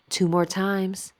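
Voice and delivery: ordinary talk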